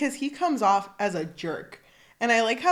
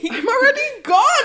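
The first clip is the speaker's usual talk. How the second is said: high, loud comic yell; mock exasperation; 11 dB louder than usual. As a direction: call it out, half laughing. Voicing character fleeing the ball.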